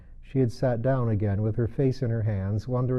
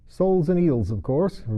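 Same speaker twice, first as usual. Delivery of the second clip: louder, quick, a touch exasperated; rather impatient; 7 semitones higher, 4 dB louder than usual. A narrator's reading. Gryphon telling Alice, rather impatient. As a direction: brush it off as obvious. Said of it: "rather impatiently".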